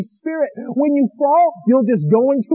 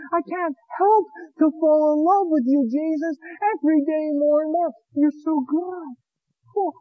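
tearful prayer; 6 semitones higher, 4 dB quieter than usual. Retold or imitated.

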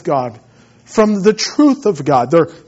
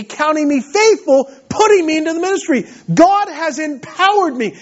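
preaching at full force; 10 semitones higher than usual. Acted. Pastor exhorting his church, preaching at full force. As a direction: proclaim it to the congregation.

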